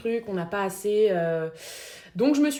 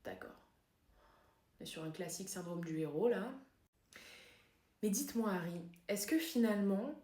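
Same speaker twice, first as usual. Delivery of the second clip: hushed, careful therapist voice; psychotherapist; 8 dB quieter than usual. Acted. Therapist asking Harry about death, soft probing voice. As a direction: keep it soft and measured, probing gently.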